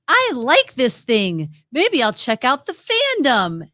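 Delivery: loud, drawn-out happy cartoon voice; gleeful innocent, slow and big; wide-eyed happy. Host acting out a happy cartoon person, wide-eyed happy. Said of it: "big-eyed, wide-eyed happy going".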